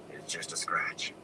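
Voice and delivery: weak unvoiced whisper. A narrator's reading. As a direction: brush it off in a whisper. Wounded Tam hushing Rand.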